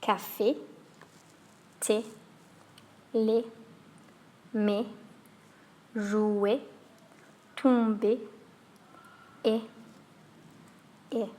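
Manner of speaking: "Café", "thé", "lait" with a short sharp French é; clipped French é endings; French é words. Shown as a demonstration. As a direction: demo each word with a closed é. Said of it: "Let's have a look at some words".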